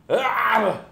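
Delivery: loud, high mock sneeze burst; fake baby sneeze. Shown as a demonstration. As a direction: imitate a baby's fake sneeze in one big yell. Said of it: "can I do a good impression".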